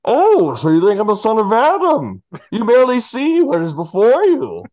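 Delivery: high, loud menacing villain voice; Bane impression. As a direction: sneer it like a hammy Bane. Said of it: "I am gonna do the impression".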